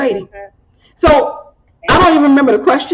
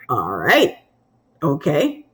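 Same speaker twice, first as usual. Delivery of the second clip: flat shrug; 8 semitones lower, 7 dB quieter, much slower than usual. Retold or imitated. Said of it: "he was like".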